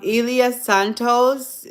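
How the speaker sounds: ordinary talk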